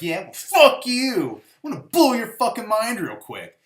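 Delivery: hammed-up comeback, leaning hard on the swearing; mock indignant outburst. All-knowing one stooping to his level, mock indignant.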